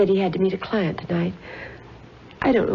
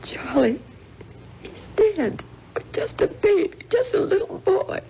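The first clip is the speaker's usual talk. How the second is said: breaking down crying; 11 semitones higher than usual. Acted. Grieving mother to police detectives, breaking into high sobs. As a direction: voice cracks high into sobs over her son. Mother hears her son is dead.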